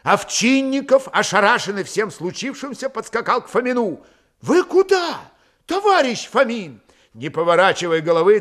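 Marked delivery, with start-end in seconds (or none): 4.4-6.8 high-pitched, startled call; stunned query. A narrator's reading. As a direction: call after the commander in bewilderment. Stunned officer calls after commander.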